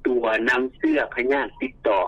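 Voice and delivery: ordinary delivery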